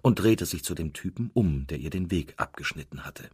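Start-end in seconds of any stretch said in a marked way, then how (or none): none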